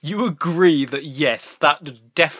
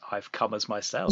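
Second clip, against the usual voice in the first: inner thought; 5 semitones lower, 9 dB quieter than usual. Retold or imitated. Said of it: "you'd be thinking".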